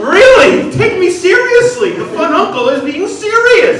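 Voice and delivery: high, very loud hammed-up tirade; exasperated yelling. Fun uncle to disobedient kids, exasperated high shouting. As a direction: scream at them to be taken seriously.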